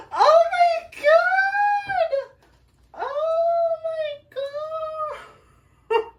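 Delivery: high, loud, slow teary cry of joy; high tearful wail of delight. Real, not acted. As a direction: sob it out, overwhelmed and happy. Overwhelmed reveal, crying.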